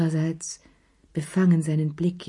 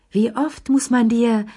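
higher, louder exasperated scolding; familiar quarrel tone; 6 semitones higher, 5 dB louder than usual. A narrator's reading. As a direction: nag him like in an old quarrel. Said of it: "Tonfall vertrauter Streitereien". (gereizter Ton eines alten Streits)

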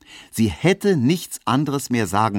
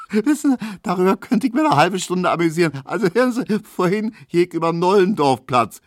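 high, laughing, quick telling; amused storyteller; 10 semitones higher, 4 dB louder than usual. Acted. Tesch telling roommates about fat Hedwig, high and laughing. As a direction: chuckle through the gossip.

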